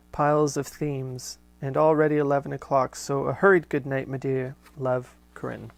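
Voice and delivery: steady, fuller read-aloud voice; letter reading. Acted. Host reading Corinne's letter aloud to listeners, measured reading voice. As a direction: read the old letter out with care.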